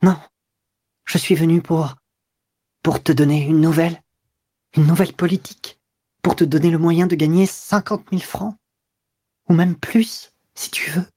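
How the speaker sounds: steady yourself through the tremble; quavering with pain. A narrator's reading. Mistress mastering her sobs to give news. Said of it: "interrompue par un chevrotement de douleur".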